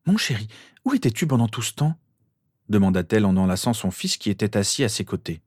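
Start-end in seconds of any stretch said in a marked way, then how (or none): 0.1-2.0 higher, tender mother's voice; gently fussing mother, pitched up; mother to her son. A narrator's reading. Mother asking her son where he was, higher and tender.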